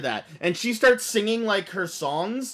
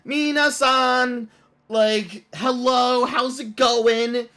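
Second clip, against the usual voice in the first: higher, slow, cutesy idol voice; 6 semitones higher, 4 dB louder, much slower than usual. Retold or imitated. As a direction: play the virtual pop star greeting her fans. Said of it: "Hatsune Miku pops up on the screen and she's like".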